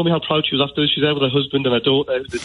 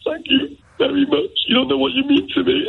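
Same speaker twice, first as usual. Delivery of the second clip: quivering emotional thanks; 10 semitones higher than usual. Retold or imitated. To his old drama teacher after her show, tearful thanks. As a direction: pour out thanks while choking up. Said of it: "I just turned into a quivering wreck".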